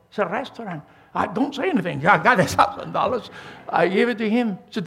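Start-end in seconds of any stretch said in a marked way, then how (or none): none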